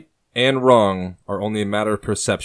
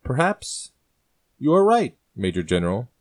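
high, lilting patronizing tone; 5 semitones higher than usual. Acted. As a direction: talk down to him sweetly. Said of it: "patronizing the man".

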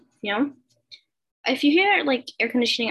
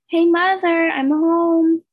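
loud, higher call through the house; calling out home; 7 semitones higher, 8 dB louder, much slower than usual. A narrator's reading. Girl calling to her mother on arriving home, loud call. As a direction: announce yourself coming in the door.

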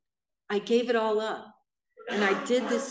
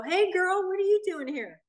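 imitating a hooker's greeting, much higher; mock hooker voice; 8 semitones higher than usual. Inmate greeting a newcomer in jail, high mock voice. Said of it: "I got to hear these hookers go".

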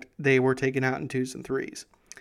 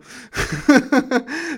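bright, higher burst of real laughter; laughing; 11 semitones higher, 5 dB louder than usual. Real, not acted. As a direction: laugh at your own joke. Laughs at his own story.

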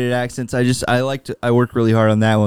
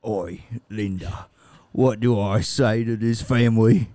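Arthur; 2 semitones lower than usual. Acted. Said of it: "Arthur, uh, and you have to go tell".

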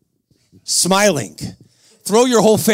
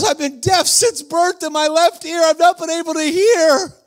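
high, slow, disbelieving cry; astonished outcry; 10 semitones higher than usual. Retold or imitated. Man deaf since birth in his left ear, acted high astonishment. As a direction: imitate a man overwhelmed by his healing.

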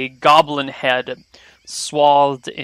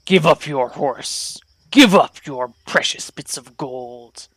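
a hint of a threatening goblin. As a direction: demand surrender like a goblin leader. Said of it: "He stops and says, in Hobgoblin".

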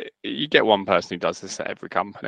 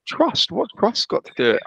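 much higher, disbelieving "trust?"; incredulous; 12 semitones higher than usual. Real, not acted. Ranting about formal emails, high and incredulous. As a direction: scoff at the word in disbelief.

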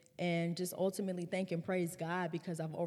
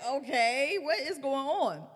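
confused; 7 semitones higher, 6 dB louder than usual. Retold or imitated. Retold shock at age six.

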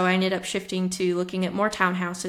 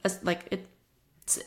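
halting, slow restarts; stuttering; much slower than usual. Real, not acted. Host tripping over how much it gained in value, stuttering. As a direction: stumble slowly over the words. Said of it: "Sorry, I'm stuttering".

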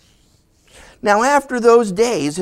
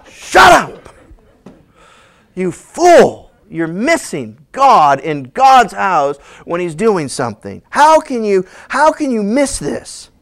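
loud, sharp rebuke; scolding angel; 4 dB louder, slower than usual. Acted. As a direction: tell him off hard. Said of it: "And the angel's like".

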